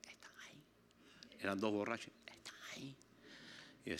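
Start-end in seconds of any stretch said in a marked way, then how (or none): 0.1-0.6 hushed secretive whisper, imitating a child; susurro. Grandson secretly points out drunk men. 2.3-3.0 very hushed imitated child's whisper; susurro. Grandson repeats the secret warning.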